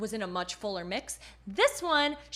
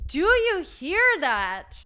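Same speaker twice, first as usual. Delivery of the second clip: excited; 6 semitones higher, 9 dB louder, much slower than usual. Real, not acted. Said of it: "excited demo".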